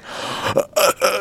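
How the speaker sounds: breathy shocked gasp; mock gasp. Real, not acted. Gasping at the friend's story, mock shocked.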